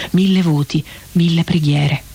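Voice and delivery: ordinary delivery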